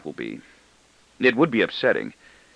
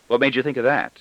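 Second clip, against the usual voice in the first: ordinary delivery, nothing marked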